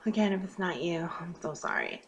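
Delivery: soft whispered aside; real whisper. Real, not acted. Aside to viewers it doesn't fit.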